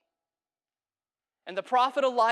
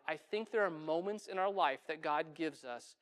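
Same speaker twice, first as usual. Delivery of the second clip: normal talking voice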